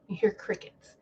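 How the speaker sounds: breathy whisper of crickets; whispered repeat. Shown as a demonstration. Whispering to viewers that nobody answered. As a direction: whisper it into the silence. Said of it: "And then you hear crickets".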